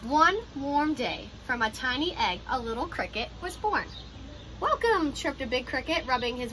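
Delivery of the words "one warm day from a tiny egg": ordinary delivery, nothing marked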